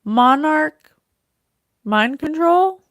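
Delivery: loud, high, astonished question; real loud burst of excitement; excited realization. Real, not acted. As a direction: blurt out the sudden realization. Realizes spirit message meaning.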